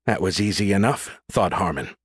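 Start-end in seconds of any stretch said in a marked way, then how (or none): none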